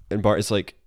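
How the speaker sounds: ordinary talk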